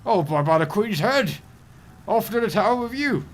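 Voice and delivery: loud, high mock-royal outburst; pompous British official. Official sentencing the unlicensed angler, pompous and outraged. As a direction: bellow a hammed-up sentence of imprisonment. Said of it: "I'm not very good at accents".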